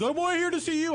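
high-pitched whooping heckler impression. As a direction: protest like a rowdy fan. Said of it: "He was like".

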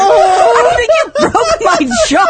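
laughing in pain after a slap; stunned, laughing, slow; just got slapped. Real, not acted. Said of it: "that wasn't too bad".

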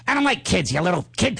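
imitated movie tough-guy menace; Joe Pesci. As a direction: act out a villain threatening a kid. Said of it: "he's doing a whole scene from the movie".

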